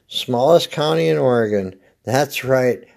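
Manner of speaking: normal talking voice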